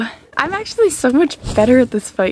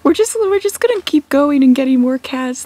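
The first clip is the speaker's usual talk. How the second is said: normal talking voice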